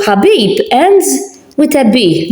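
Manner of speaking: ordinary delivery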